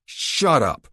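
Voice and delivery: loud, stern command; curt order. A narrator's reading. Caleb silencing the handcuffed drunk, curt. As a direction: shut him down hard.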